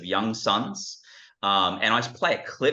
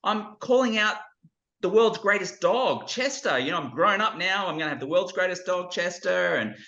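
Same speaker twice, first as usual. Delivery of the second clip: put-on high, bright-eyed child's voice; a kid boasting about the dog he'll own; eager boy; 10 semitones higher than usual. Boy telling his grown-up self about his dream dog.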